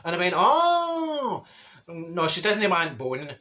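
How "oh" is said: mock realisation. Realising the misunderstanding.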